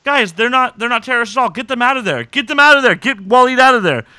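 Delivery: loud, high urgent shout; acted panicky yelling, high and loud; frantic mock alarm. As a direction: shout an urgent order, hammed up. Acting out a frantic rescue order.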